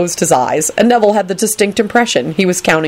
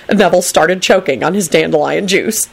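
ordinary delivery

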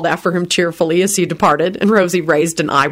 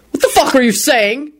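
yelled; 7 semitones higher than usual. A narrator's reading. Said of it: "Quentin yelled".

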